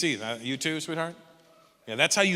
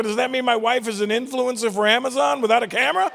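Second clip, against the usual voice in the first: mock incredulous; 9 semitones higher, 5 dB louder than usual. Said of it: "I go".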